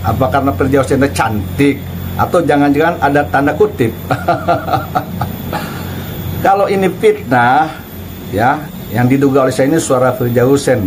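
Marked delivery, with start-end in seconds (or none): 4.0-6.4 mocking snicker. Real, not acted. Mocking the cleric's silence.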